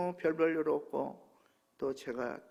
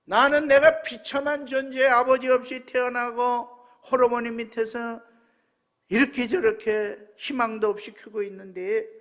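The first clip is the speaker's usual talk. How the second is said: loud, higher-pitched impassioned testimony; full-force preaching; 7 semitones higher, 11 dB louder than usual. Real, not acted. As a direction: cry out the testimony to the congregation. (목소리 높여 외치는 설교)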